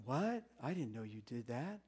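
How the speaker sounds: soft, low, baffled God voice; a lightly voiced, puzzled God; confused God. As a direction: play God pretending not to know. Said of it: "He's not going".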